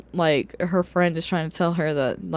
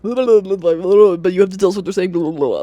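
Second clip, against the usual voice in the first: louder sneering parody of a cocky man; mock-shouted nagging of the doctor; mocking loud demand; 7 dB louder than usual. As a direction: blast it out like a pushy jerk ignoring her. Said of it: "and he's like".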